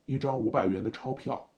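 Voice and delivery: low, loud, impassive driver voice; cold. A narrator's reading. Driver demanding a banknote from his passenger, cold. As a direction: state the demand low and coldly. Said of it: "司机冷淡地说".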